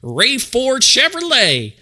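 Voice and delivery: loud, high hammed-up Southern drawl; hammed-up car-dealer pitch in a fake Southern accent. Shown as a demonstration. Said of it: "enjoy the fake accent".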